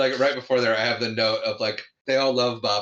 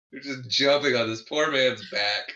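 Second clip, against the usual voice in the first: ordinary talk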